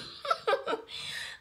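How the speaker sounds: unvoiced, high burst of laughter; high breathy laugh. Real, not acted. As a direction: cackle at your own joke. Laughs at own joke.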